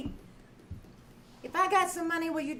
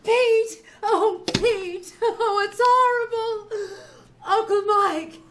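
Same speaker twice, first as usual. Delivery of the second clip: tearful, louder and higher cries of grief; crying; 4 semitones higher, 6 dB louder, much slower than usual. Acted. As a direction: break down sobbing over the news. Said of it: "stop crying".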